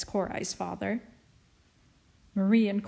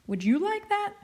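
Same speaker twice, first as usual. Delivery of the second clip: high gentle question; 12 semitones higher than usual. A narrator's reading. Father asks children.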